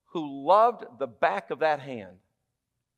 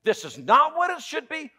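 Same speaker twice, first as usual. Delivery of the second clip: pouting complaint; 10 semitones higher than usual. Acted. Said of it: "while he's pouting in the prison".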